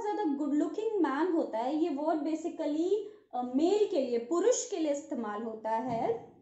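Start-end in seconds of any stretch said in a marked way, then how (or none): none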